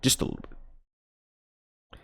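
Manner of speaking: slow, soft whispered repeat; teasing whisper. Real, not acted. Streamer whispering to chat that they'll get into it, teasing. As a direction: breathe it out conspiratorially.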